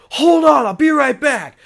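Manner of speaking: high, urgent holler; imitated excited shout. Drunk friend runs off mid-concert, excited shout. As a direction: yell it as you dash away.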